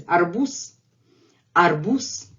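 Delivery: "Arbuz" ending in a hissed s; voiceless final s. Shown as a demonstration. Teacher shows the word's end, z devoiced to s. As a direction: let the z of "Arbuz" turn into s. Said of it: "it becomes voiceless".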